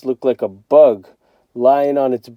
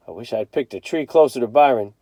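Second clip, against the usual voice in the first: ordinary delivery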